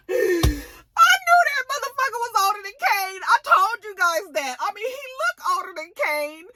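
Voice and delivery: gleeful high-pitched I-told-you-so. Real, not acted. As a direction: squeal it like you called it.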